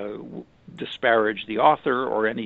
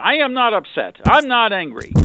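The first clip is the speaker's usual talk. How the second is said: higher, louder mock angry denial; 8 semitones higher, 8 dB louder than usual. Upset partner told to calm down, snapping back in a mock angry yell. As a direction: bark it back like someone insisting they're fine. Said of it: "usually you might hear something".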